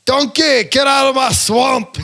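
high loud slow shouting ogre; Shrek impression. Shrek yelling at Donkey to leave, high, loud and slow.

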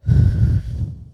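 heavy unvoiced sigh; loud exhale. Real, not acted. Guest breathing out during the session, loud sigh. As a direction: let the breath rush out loudly.

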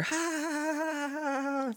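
high mock canned laughter; ha-ha it way up like a laugh track; sitcom audience laugh. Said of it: "I had to hear".

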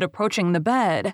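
ordinary delivery, nothing marked

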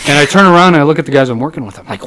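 usual speaking voice

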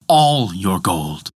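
slow, low, booming echo off stone walls; booming cavern echo. A narrator's reading. The cavern echoing the demand for gold, slow low boom. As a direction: let it roll out slowly like a cave answering. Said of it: "boomed the cavern".

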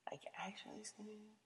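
unvoiced whisper to herself; whispered aside. Real, not acted. Muttering to herself about a silly question, whispered. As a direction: breathe it under the breath.

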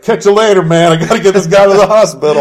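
loud, high mocking buddy voice; mock Chewie. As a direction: blurt it out like a careless pal. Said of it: "but Chewie's like".

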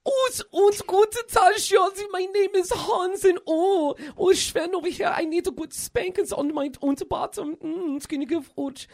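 high-pitched mock German accent. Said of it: "I'm doing a German accent".

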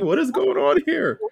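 mock-shocked what is going on; stunned disbelief. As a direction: squeal it in disbelief. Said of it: "I was like".

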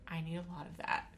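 whispering. Retold or imitated. Retold request, whispered.

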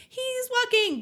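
excited. Retold or imitated. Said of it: "And she's like".

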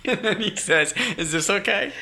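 high-pitched, laughing imitation; laughing retelling. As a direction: crack up while quoting the man. Laughing through his own absurd retelling.

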